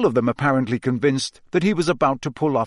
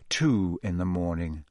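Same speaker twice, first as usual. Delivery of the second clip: weary; 7 semitones lower, 6 dB quieter than usual. A narrator's reading. Time passing, drowsier.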